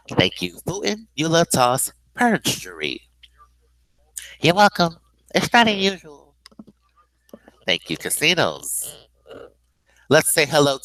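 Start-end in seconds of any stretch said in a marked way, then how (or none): 4.4-6.4 high put-on reply; sandwich-chef guest character. Guest replying to host's thanks, high character voice.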